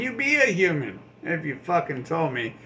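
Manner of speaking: ordinary talk, nothing marked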